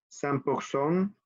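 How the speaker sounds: cent pour cent slowly with French r; francés lento. Shown as a demonstration. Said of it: "Ok, repetimos".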